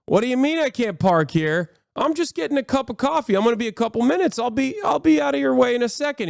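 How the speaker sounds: rising, fast, whiny excuse-making; whiny asshole driver. Retold or imitated. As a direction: play the entitled jerk talking his way out. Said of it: "and he's an asshole".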